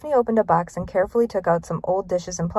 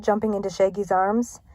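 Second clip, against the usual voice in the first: plain reading voice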